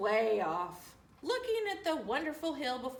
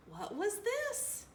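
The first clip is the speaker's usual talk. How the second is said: usual speaking voice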